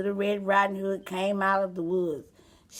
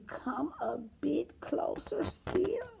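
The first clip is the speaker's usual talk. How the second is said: slow unvoiced luring whisper; creepy whisper; 8 semitones higher, 6 dB quieter, slower than usual. A narrator's reading. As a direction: coax her in, barely breathing. Wolf luring her closer.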